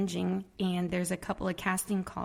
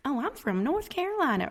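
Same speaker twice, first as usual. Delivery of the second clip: put-on Southern accent; 7 semitones higher than usual. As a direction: put on a girl's thick North Carolina accent. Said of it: "this girl was talking very Southern".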